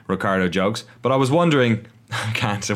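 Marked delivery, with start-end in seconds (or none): 1.0-1.9 slipping into a mock Cockney accent; lightly hammed-up Cockney accent, a bit louder. Host voicing Ricciardo slipping into mock Cockney accent. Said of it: "Once I go into Cockney".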